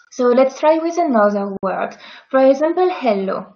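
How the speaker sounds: ordinary talk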